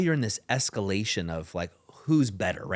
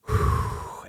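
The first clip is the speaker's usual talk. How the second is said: long audible breath out; slow unvoiced sigh of relief; relieved exhale; 3 dB louder than usual. Shown as a demonstration. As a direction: let the air go, relaxing.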